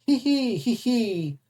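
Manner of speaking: high-pitched wheezing giggle "hee hee". A narrator's reading. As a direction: cackle it out high like a silly bear. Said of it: "His brother Aureus laughed".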